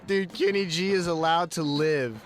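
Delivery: usual speaking voice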